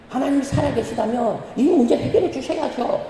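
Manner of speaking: high-pitched, demanding mock plea to God; threatening prayer. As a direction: push God with a hammed-up ultimatum. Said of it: "막 협박하듯이 기도합니다".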